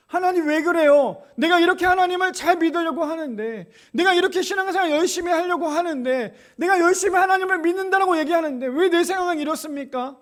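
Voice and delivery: loud, high, pleading complaint; complaint to God. Retold or imitated. Believer loudly protesting to God, high and loud. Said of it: "하나님 원망하잖아요".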